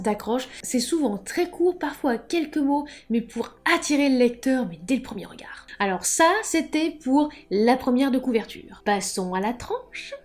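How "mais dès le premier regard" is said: whisper. Real, not acted. Stressing the point about first impressions.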